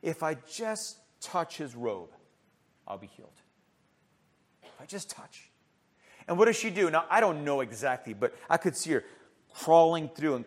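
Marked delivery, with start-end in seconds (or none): 0.0-5.5 soft and low, trailing to almost nothing; inner thought. Acted. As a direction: think it quietly, barely aloud. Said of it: "she just thought to her mind".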